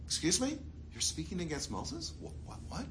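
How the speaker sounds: hushed, high-pitched disbelief; quiet put-on indignation; mock incredulous. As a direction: act taken aback, very softly. Acting God's reproach to Miriam.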